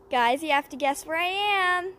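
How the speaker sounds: high loud outdoor yelling; loud excited shouting. Real, not acted. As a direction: shout over the bouncing with excitement.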